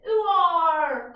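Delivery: loud pirate cry of ooh-ar; pirate shout. Acted. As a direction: bellow it like a pirate captain. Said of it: "He likes to shout".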